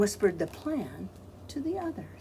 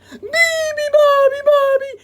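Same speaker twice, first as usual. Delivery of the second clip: very high loud shriek; 15 dB louder than usual. Acted. Seals shriek the song. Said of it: "shrieked the seals".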